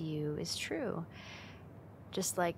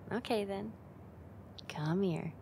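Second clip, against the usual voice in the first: ordinary delivery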